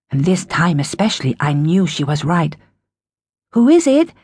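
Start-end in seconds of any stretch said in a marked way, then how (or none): 3.5-4.1 calling through door. A narrator's reading. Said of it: "speaking through the wood".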